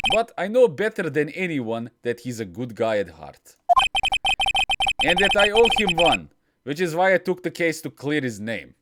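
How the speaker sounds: put-on lightly done Russian accent for Phoenix Wright; understated Russian accent lawyer narration. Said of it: "Japanese American guy is suddenly Russian".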